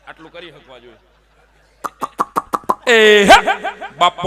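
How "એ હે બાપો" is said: huge high yell out the window; full-throated herder's shout; shouting at buffalo. Acted. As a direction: bellow to shoo a buffalo.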